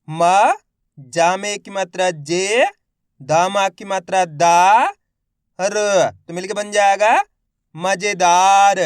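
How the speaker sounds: मज़ेदार stretched very slowly and loudly; syllable by syllable demo. Shown as a demonstration. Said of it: "मेरे साथ साथ इनको repeat करना है". (अक्षर-अक्षर जोड़कर धीमे पढ़ना)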